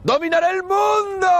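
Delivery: high, shouting Bond villain; mad scientist. Acted. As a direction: gloat like a movie supervillain. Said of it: "como si fuéramos el malo de una película de James Bond".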